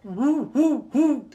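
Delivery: loud, high imitated owl hoots; forceful, evenly spaced owl calls; owl hooting. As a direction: hoot out loudly like an owl. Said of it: "It goes".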